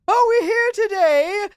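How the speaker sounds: high, slow ceremonial drawl; mock priest, drawn out and high-pitched; priest impression. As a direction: intone it like a wedding priest. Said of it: "you see the priest say".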